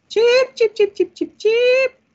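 high, loud chirpy calls; high dog-calling chirp. Acted. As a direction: call the dog in a squeaky voice.

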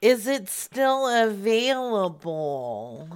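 drawn-out question, rising at the end; slow, deliberate question. Real, not acted. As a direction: stretch each word out, lift the ending. Repeats question to slow-following old man.

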